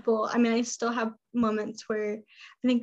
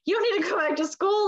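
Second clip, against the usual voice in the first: scoffing, pitched way up; dismissive parents; 9 semitones higher than usual. Retold or imitated. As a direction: brush it off like it's obvious. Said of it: "now they're like".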